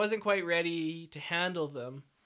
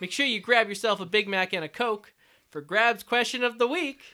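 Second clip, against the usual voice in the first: mock ad read; 4 semitones higher, 6 dB louder than usual. Mock commercial tagline.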